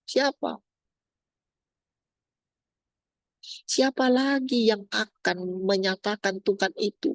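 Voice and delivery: high, impassioned rhetorical questions; urgent preaching. Acted. Preacher asking who is left to follow, high and urgent. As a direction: press each question on the congregation.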